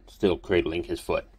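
usual speaking voice

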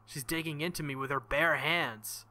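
normal talking voice

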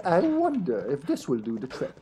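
ordinary talk, nothing marked

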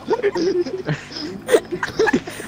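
squealing laugh breaking into cry; helpless high-pitched laughter; high wheezing laugh. Real, not acted. Cracks up after silly line.

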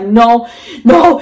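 climbing, half-laughing cries of refusal; retold frantic refusal. Woman retelling her refusal to leave the car, frantic. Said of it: "losing my mind".

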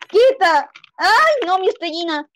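high yell, then a wail; shrieking protest. Real, not acted. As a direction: shriek as your star is stolen. Loses star, yells at rival. (grito agudo de protesta)

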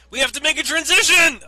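high, loud strained yell; panicked mock shout. Announces a sudden transition.

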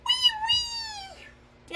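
tiny high, soft wailing; pretend toy crying. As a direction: squeal out a little mock cry. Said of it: "Don't cry".

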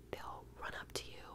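normal talking voice

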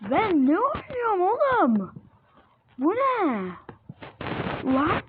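high, loud outraged yell; baffled shout. Real, not acted. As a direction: shout it in disbelief at the screen. Baffled by a game jump scare.

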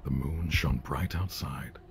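hushed, eerie bedtime-story whisper; whispered creepy story narration. A narrator's reading. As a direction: breathe the tale like a ghost story.

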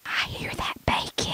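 eerie unvoiced whisper. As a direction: breathe it like a ghost. Spooky whisper about bacon.

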